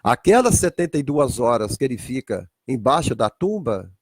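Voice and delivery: normal talking voice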